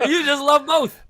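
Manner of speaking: laughing. Real, not acted. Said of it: "teasing while laughing".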